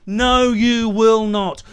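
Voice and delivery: slow, loud, deliberate no; flat refusal. Real, not acted. Rejecting the Greens' claim with a slow loud no. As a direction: lay down the law, word by word. Rejecting policy line just read.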